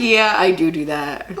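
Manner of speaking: slow, low, owning up; sheepish admission. Retold or imitated. Wife admitting her habit to her husband, sheepish.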